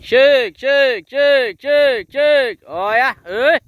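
high, loud call to the herd; calling horses. Real, not acted. Farmer calling his mares in from the pasture, loud high call. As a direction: sing out to bring the mares in.

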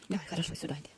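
soft coaxing whisper; hushed whisper to a timid dog. Real, not acted. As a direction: whisper gently so the dog isn't scared.

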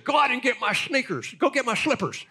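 high, loud mock orders to a dog; hammed-up bossy owner. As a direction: boss the dog around, big and showy. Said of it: "He said".

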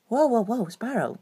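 ordinary talk

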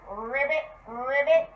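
lightly voiced frog croaks; two questioning ribbits with a rolled start. Shown as a demonstration. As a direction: offer ribbits as a silly guess. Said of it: "what sound does the cow make?".

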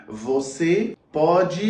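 very slow, each syllable drawn out; slow, careful Portuguese; slow teaching demo. Shown as a demonstration. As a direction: spell it out slowly for a learner. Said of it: "في البرتغالية نقول".

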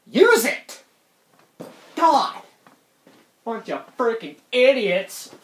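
hammed-up exasperation; exasperated rant. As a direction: lash out at the idiots. Mock rant at people with few words.

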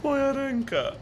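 high pained yelps; pained cries. Real, not acted. Streamer after hitting the microphone, pained yelps. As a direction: yelp from a hit hand.

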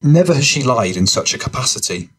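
ordinary delivery, nothing marked

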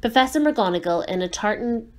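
usual speaking voice

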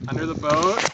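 loud urgent warning; urgent call. Real, not acted. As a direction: call it out fast, alarmed. Fish runs under boat.

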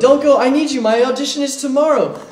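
high loud frantic begging; desperate pleading. Acted. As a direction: plead loudly, clinging on. Student begs teacher to stay.

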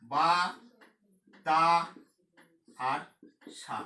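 "বা", "তা", "সা", each standing alone; long pauses between "বা", "তা" and "সা"; split into syllables. Shown as a demonstration. Teacher counting a word's syllables, split into syllables. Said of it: "এই শব্দে দল আছে".

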